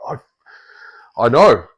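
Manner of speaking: stammer, then insist, fed up; frustrated. Retold or imitated. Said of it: "this one I'm getting a bit frustrated".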